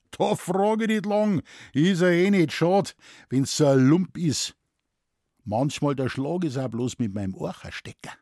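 brash Bavarian forester, starting high and settling; Moosbichler. Acted. Forester shrugs off killing to a shocked scholar, brash and casual. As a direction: brush it off in broad Bavarian.

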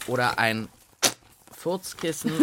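ordinary talk

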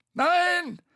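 high, loud call; called from above. A narrator's reading. Bo calling down to Tete, high shout. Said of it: "rief Bo von oben".

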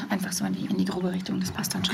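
soft breathy whisper, no voice; whispered aside. Real, not acted. Host reassures Jens about where to speak, whispering. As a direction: reassure him quietly so the mic barely hears.

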